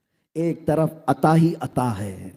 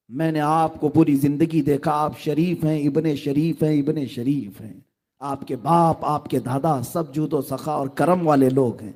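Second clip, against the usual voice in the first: usual speaking voice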